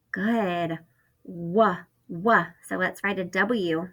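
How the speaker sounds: ordinary talk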